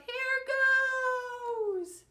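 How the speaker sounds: high, loud yell, nose held. A narrator's reading. Brother jumps in holding his nose, yelling high. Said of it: "He held his nose and yelled".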